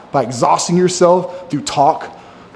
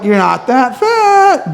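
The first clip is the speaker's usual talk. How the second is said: slow, higher mock self-reassurance; self-deluding reassurance; 5 semitones higher, much slower than usual. Quoting his past self, slow mock reassurance. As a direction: coax yourself, dragging it out. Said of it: "I was like".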